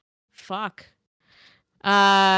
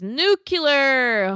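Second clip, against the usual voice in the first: loud, higher Homer shout; Homer Simpson; 7 semitones higher, 7 dB louder than usual. Retold or imitated. Reading a chat message as Homer Simpson, loud. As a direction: bellow it like Homer. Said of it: "Homer Simpson".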